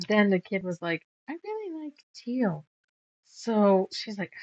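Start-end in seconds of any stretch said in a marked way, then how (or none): 1.3-2.7 put-on child voice. Kid's request voiced.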